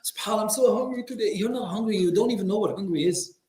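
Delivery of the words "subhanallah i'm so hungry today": quick, higher mock moan about hunger; whiny complaint. Preacher mocking a man moaning about hunger. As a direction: parody a self-pitying faster. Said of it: "when you talk like that".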